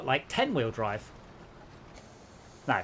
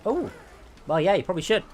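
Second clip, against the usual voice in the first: ordinary talk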